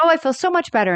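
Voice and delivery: a light echo of a cheerful Rose; quoting Rose, relieved. Retold or imitated. Host repeating Rose's line, lightly voiced. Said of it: "She's like".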